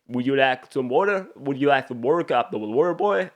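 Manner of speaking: odd put-on voice climbing high at the end; goofy character question, pitch lifting on the last words; bad Waterboy impression. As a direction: badly imitate a silly-accented water boy. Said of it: "That was a bad impression".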